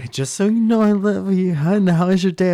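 loud, high, gushing love declaration; gushing affection. Acted. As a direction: overdo the sweet talk. Exaggerated loving phone message.

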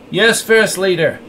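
obey through held-back anger; restrained anger. A narrator's reading. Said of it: "bit his lip in anger".

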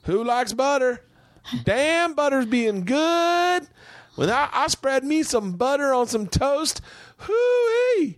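very high, drawn-out Southern holler; mock Southern family impression. Husband imitating wife's family, hammed-up Southern holler. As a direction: whoop it up as a hammed-up Southern yokel. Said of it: "This is my impression of your family".

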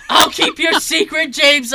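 put-on loud, high, drawn-out childlike earnestness; hammed-up kid swearing secrecy at full volume; excited kid. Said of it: "And he's like".

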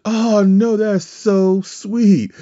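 high, slow, gushing imitation of her; imitating Mia. Teller voicing the wrestler's flattered reply, high and drawn-out. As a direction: coo it back like she's touched. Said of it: "She's like".

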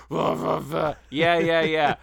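loud guttural clown screech; distorted monster growl. Retold or imitated. Mimicking the horror film's distorted voice, loud mock growl. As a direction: imitate the distorted scary voice.

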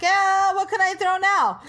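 excited quote. Retold or imitated. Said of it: "He's like".

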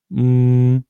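mock hum, pushed out to disrupt; disruptive class noise. A pupil humming loudly in class to annoy the teacher. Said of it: "just kept making noises, just the".

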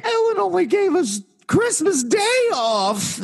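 very high-pitched mocking imitation of a complainer; mock complaining employee. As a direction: whine it in a put-on high voice. Mimics complaining Ellen employees.

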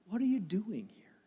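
soft whisper as God's voice; God's gentle whisper to Elijah; whispered question. Acted. God's voice in the stillness.

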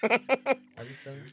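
laughing it off; giggling through it. Real, not acted. Laughs off the question.

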